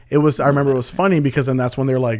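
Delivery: ordinary delivery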